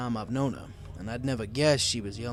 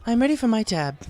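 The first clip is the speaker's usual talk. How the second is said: higher, louder, self-assured lady; confident Rarity; 11 semitones higher, 6 dB louder than usual. A narrator's reading. Rarity asks the bartender for her tab, confident. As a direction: announce it with poise. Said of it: "she said confidently".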